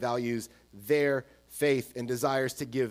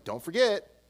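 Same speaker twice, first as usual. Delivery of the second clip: higher-pitched throwaway sign-off; mock casual goodbye, pitched up; mock offhand reminder; 7 semitones higher than usual. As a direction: toss it in lightly, like an afterthought. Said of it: "just randomly throwing in".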